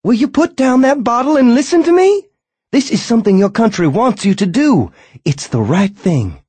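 high, loud screaming wife. A narrator's reading. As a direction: scream it at him in fury. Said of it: "Screaming now".

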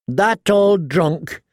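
slow, sneering contempt for a dead king; scornful. A narrator's reading. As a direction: drag out the scorn. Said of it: "said Gendry scornfully".

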